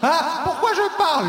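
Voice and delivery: high loud squawk of a question; high shout. Acted. Comic on stage squealing at the crowd, high and loud.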